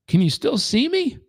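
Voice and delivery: lightly voiced old drifter; old man voice. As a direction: ask like a gentle old man.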